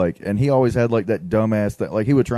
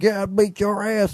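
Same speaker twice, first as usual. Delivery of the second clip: much higher, slow friendly mock threat; old Southern coach; 10 semitones higher, slower than usual. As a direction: tease like a gruff coach, slowly. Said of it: "old Southern man threat".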